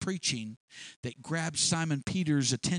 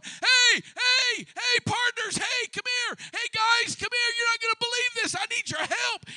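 much higher and loud, hollering for his partners; excited calling out; 4 dB louder than usual. Acted. Simon calling partners to the overflowing nets, excited yell. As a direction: shout across the water in disbelief. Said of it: "I can just picture Simon going".

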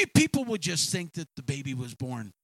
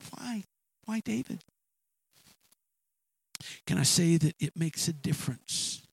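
ordinary talk, nothing marked

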